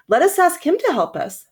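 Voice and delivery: high eager plea of toys; toys crying out together. Acted. Dolls and toys to each other, high eager plea. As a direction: call out hopefully as a toy. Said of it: "cried out together".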